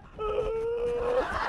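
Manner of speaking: sharp, high gasp of alarm; frightened gasp. Retold or imitated. Colleague acting her own startled gasp on the street. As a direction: gasp as if danger just appeared. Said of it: "and I was going".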